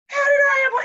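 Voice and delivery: high, loud incredulous cry; retold astonishment. Patient looks down at himself after surgery, astonished. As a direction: imitate someone utterly baffled.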